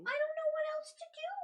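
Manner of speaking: very high, loud wail of helplessness; exasperated, voice shooting up; desperate outburst. Acted. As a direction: cry out at the end of your rope. In-character outburst when challenged.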